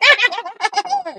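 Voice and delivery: very high mock villain cackle; maniacal cackle. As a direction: cackle wildly like a comic-book goblin. Villain's laugh acted after attack.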